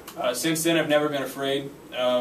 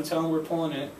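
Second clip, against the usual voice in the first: normal talking voice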